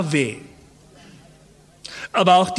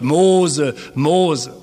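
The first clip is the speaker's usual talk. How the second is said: voice of God; 2 semitones lower than usual. Retold or imitated. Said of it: "die Stimme Gottes hört".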